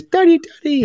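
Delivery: high-pitched imitation of a daughter calling Daddy; put-on high child's voice, American-British accent; daughter's American-British accent. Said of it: "in her American British accent".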